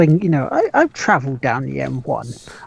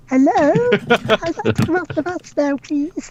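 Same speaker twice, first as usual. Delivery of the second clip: put-on high, timid plea from a stranded kid; a forgotten kid calling from the bus; 12 semitones higher than usual. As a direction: lift your voice like a lost child asking politely. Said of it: "He's the one stuck on the bus".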